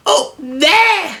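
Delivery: very high frightened squeak; squeaky Piglet voice. Acted. Piglet pointing for Christopher Robin, very high squeak. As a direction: point and squeal like a tiny scared pig. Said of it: "doing that Piglet voice screaming".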